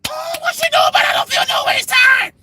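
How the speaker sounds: very high, whooping cries; hammed-up gospel hollering; mock church shouting. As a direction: shriek like a fired-up congregation. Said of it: "people in the church just started".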